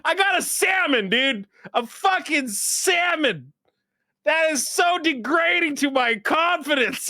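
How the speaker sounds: high, loud comic indignation, drawn out slowly; mock outrage. Real, not acted. Telling his co-host about his salmon Patronus, in loud mock outrage. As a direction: rant like a wounded ego over a silly result. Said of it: "I was so mad".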